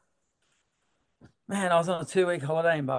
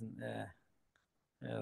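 ordinary talk

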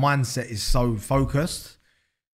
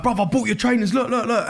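a touch of an eager fan showing off; higher and eager, showing off the trainers; 10 semitones higher, 4 dB louder than usual. Retold or imitated. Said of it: "And they're like".

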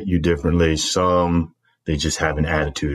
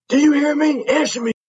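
high, loud drill sergeant yell; angry dressing down; 3 dB louder than usual. Retold or imitated. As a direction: demand an answer, furious. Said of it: "Storming over to me".

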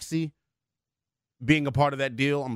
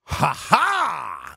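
high, drawn-out booming laugh; big put-on "ha-HA"; Craig Melvin; 4 semitones higher, 3 dB louder than usual. Co-host mimicking a known voice for fun, impression laugh. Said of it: "In my Craig Melvin voice".

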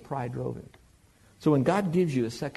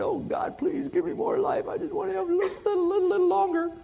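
very high, whiny falsetto begging; squeaky fake-tearful pleading, stuttering at the end; mock crying plea. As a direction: beg like a blubbering child, hammed-up. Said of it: "So he cried".

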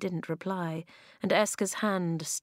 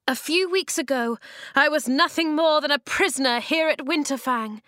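raised, loud rallying cry; cried out; 8 semitones higher, 5 dB louder than usual. A narrator's reading. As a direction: call it out high and strong. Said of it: "she cried".

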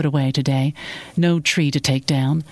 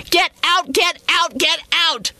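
very high-pitched, repeated frantic cries; screaming; 13 semitones higher than usual. A narrator's reading. Woman driving him from her house, screaming. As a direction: scream each order out at him. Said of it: "She kept screaming".

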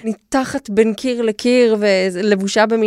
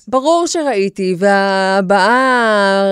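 usual speaking voice

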